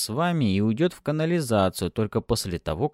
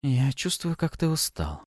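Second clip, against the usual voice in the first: breathy purring seductress; 5 semitones higher than usual. A narrator's reading. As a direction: coax him tenderly. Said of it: "продолжала она".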